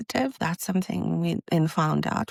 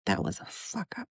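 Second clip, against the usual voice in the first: low, hushed whisper of a husband; hushed aside; 8 semitones lower, 8 dB quieter than usual. Retold or imitated. Husband coming out of theatre, hushed and grim. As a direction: mutter it under your breath, shaken.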